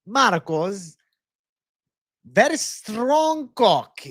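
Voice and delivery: put-on high, halting comic foreign accent; Borat. Streamer riffing on a fighter for the chat, hammed-up Borat accent. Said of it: "Need the Borat impression again?".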